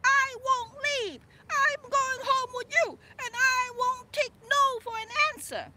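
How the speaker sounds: a burned hero refusing to leave; proclamation. Retold or imitated.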